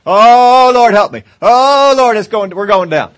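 loud, high, frantic wailing plea; a hammed-up "Oh, Lord, help me", loud and high; mock panicked prayer. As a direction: ham up the panic of a sinking believer. Mock panicked believer praying.

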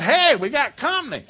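high, loud shout over the commotion; calling out. Retold or imitated. Son alerting wrestling parents to company, calling out loudly. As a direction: holler to interrupt them, half laughing.

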